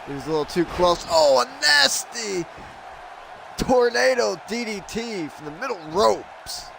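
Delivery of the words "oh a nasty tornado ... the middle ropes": high, slow, awed "Oh"; excited commentator. Real, not acted. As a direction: call the big move with a gasp of awe. Commentator reacting to big move.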